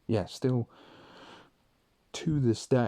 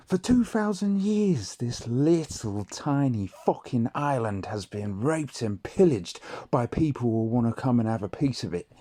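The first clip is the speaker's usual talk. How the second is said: loud, raised-pitch nationalist tirade, performed; impassioned speech; 4 semitones higher, 7 dB louder than usual. Acted. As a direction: declaim it like rallying the troops, building to shouts. Recites a rousing nationalist speech from memory.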